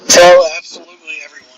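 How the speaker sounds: windy, blown-out mic; speaking through heavy wind noise; in strong wind. Real, not acted. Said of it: "I sound like I'm in a wind tunnel".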